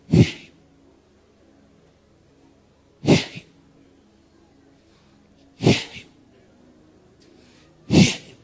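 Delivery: high, loud call, then breathy repeats; proclaiming shout. Acted. Preacher voices heaven's call over the church, shouting. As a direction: announce it like heaven calling.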